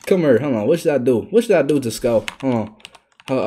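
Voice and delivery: ordinary talk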